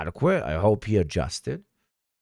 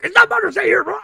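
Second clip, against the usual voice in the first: high, loud exasperated squeal; high, loud mock outrage; mock outraged squeal; 6 dB louder, faster than usual. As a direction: shriek it like you're losing your mind. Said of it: "I was like".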